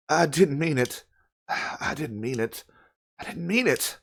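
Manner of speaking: soft, halting guilty repetition; hushed acted remorse with a sigh; remorseful murmur. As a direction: repeat it quietly, as if shaken by guilt. [name]'s guilty repeating after a quarrel.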